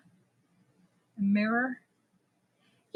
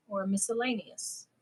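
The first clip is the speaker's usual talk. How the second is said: ordinary delivery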